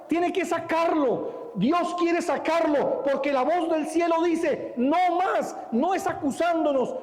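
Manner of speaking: más alto y enfático, proclamando; predicación enfática. Acted. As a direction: clama contra la acusación. Predica con fuerza. (predicación enfática contra la acusación)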